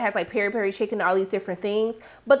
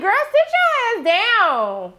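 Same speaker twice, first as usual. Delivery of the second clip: mock-scolding, high and sharp; sassy put-down; 8 semitones higher than usual. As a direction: snap her back into her seat. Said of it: "I was like".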